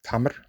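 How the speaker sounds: tamr with a light, plain T; teacher saying tamr, non-emphatic T. Shown as a demonstration. Teacher contrasting plain T in tamr. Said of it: "compare the two sounds again".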